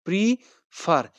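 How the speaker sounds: slow "prefer" with the second syllable fer pressed hard; stress on FER. Shown as a demonstration. Teacher shows students the stressed last syllable, slowly. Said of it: "इस पर हम stress देंगे".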